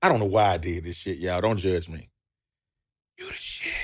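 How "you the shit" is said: whisper. Retold or imitated. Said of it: "I whisper in his ear".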